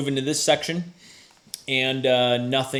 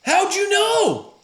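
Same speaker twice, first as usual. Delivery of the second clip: surprised guard; 4 dB louder, slower than usual. Acted.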